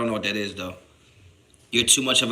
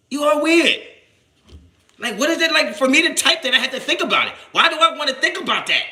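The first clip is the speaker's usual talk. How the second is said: much higher and louder, disbelieving; incredulous shout; 14 semitones higher, 4 dB louder than usual. Real, not acted. Streamer to a Twitter troll, high incredulous. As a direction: yelp it in disgust. Calling out commenter.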